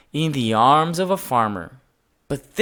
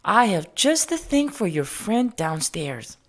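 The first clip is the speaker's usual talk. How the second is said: higher, kindly lady voice; Mrs Gray's voice; 6 semitones higher than usual. A narrator's reading. Mrs Gray offering George dress-up clothes, in a kindly voice. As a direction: voice her as a gentle older lady. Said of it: "she said".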